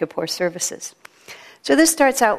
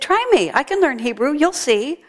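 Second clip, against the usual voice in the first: much higher, squawky little parrot; 7 semitones higher than usual. Acted. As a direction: pipe up like an eager parrot. Parrot voiced in told joke.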